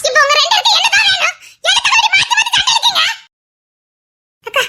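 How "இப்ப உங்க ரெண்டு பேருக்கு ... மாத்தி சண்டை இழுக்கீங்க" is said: exasperated shout. Acted. Woman yelling at both quarrelling men, exasperated. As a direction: break up the fight with a yell.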